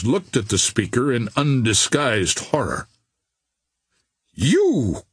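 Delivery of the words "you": high, shocked accusation; horrified cry. A narrator's reading. Captain horrified by Billy's confession, crying out. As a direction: cry it out in horror. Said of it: "he cried".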